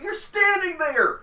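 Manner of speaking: high, drawn-out retort; mock exasperation. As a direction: act out fed-up disbelief at a friend. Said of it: "I'm getting pissed".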